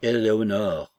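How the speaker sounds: reproving call of a name; restrained warning rebuke; remonstrance. A narrator's reading. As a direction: call "Éléonore" as a pointed reproach. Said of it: "un ton particulier de remontrance".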